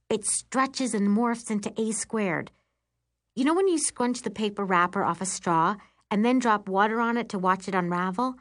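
usual speaking voice

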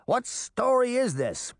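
high, drawn-out impatient question; impatient, pitched well up. A narrator's reading. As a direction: demand an answer like an exasperated boy. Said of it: "Puck cried impatiently".

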